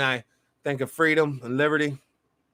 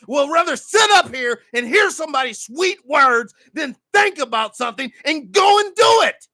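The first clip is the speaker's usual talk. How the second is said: fiery preaching; 6 semitones higher than usual. Real, not acted. Host preaching to brothers and sisters about laziness, shouting. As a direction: preach it hard, hammer the crowd. Preaching at full force to listeners.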